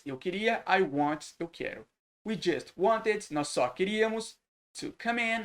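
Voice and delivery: usual speaking voice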